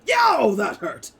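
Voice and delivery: loud, high yelp of a hurt character; pained holler carrying from afar; Barnabas yelling in pain. Acted. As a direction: shout it out, drawn out, as if just struck. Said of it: "you just hear Barnabas".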